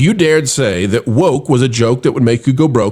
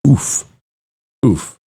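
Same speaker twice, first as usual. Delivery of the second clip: ordinary delivery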